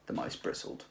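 plain reading voice

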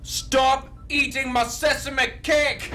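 slow, high yell; shouted, slow. Acted. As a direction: bellow it, drawn out. Shouted catchphrase.

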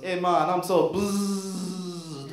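very slow, hazy Jamaican drawl ending in a buzz; a stoned Rasta bug buzzing by. Pretend. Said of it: "it goes".

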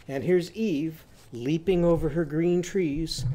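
usual speaking voice

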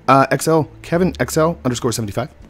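ordinary talk, nothing marked

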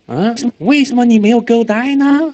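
teacher jokingly rewords the question for students; mock slangy question. Shown as a demonstration. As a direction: ham it up like a cheeky kid. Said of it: "是不是这意思？".

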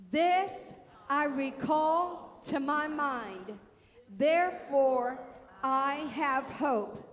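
slow, loud, drawn-out scripture reading; preaching at full force. Acted. Preacher leading the church in reading, slow and loud. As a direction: proclaim each line to the crowd.

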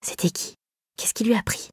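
hushed, breathy whisper; imagined gossip whispered between friends. Retold or imitated. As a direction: whisper behind her back. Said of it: "Ils chuchotaient sans doute".

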